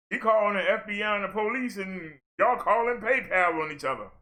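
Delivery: ordinary talk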